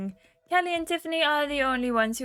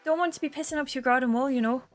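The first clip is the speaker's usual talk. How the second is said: put-on quick Irish accent, pitched higher; [name]; 6 semitones higher, faster than usual. [name] explains her slang to Tom, in a quick Irish accent. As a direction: rattle off the explanation in a Cork lilt.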